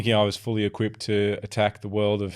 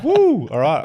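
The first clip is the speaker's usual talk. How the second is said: high, loud mock celebration; hammed-up thrill of a new start; excited whoop; 12 semitones higher, 11 dB louder, slower than usual. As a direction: whoop it up with a laugh. Acting out delight at job offer.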